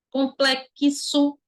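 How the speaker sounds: COMPLEXO very slow, "com-plek-ki-so"; sounding out both phonemes. Shown as a demonstration. Teacher proving X has two phonemes, sounded out slowly. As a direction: pull COMPLEXO apart so K and S are heard. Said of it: "Tem dois fonemas".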